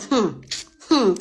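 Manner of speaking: imitated disapproving hum, falling; disapproving hmm. Imitating her reaction to talk against Wunmi, disapproving. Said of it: "as if they are talking about Wunmi in a bad way".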